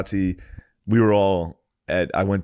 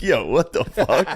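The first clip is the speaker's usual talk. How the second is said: shocked yo; 6 semitones higher, slower than usual. Retold or imitated. Nate shocked, retold.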